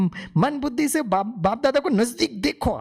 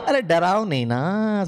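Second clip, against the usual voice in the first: normal talking voice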